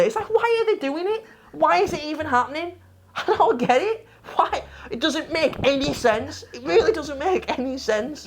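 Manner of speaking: high, laughing disbelief; laughing incredulous rant. Real, not acted. Ranting, laughing at the game.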